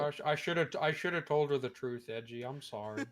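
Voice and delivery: lightly voiced gruff Joel, sorry and hesitant; a hint of a guilty Joel apologizing; Joel impression. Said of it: "I don't know why I'm fucking pulling his voice".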